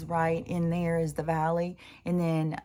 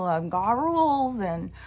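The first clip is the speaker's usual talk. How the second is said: lightly voiced stickler listing duties; rigid rule-follower; 5 semitones higher, faster than usual. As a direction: imitate a friend reciting her rules. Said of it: "she's one of those people".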